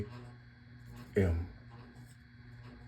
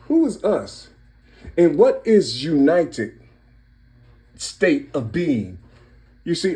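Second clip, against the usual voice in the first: high, loud, building sermon-style shouting; fired-up preaching; 8 semitones higher, 5 dB louder than usual. Real, not acted. Man preaching numerology to his audience, fired-up and loud. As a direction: raise the pitch and volume, hammering each question home.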